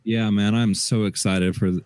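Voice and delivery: ordinary talk, nothing marked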